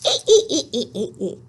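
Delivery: giggled "ee-ee-ee" falling away. Shown as a demonstration. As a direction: giggle on repeated long E sounds. Giggle sound ending the chant.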